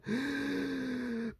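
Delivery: high startled gasp; a quick high gasp, barely voiced; sharp gasp. A narrator's reading. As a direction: gasp in sudden fright. Narrator reacts to the bear.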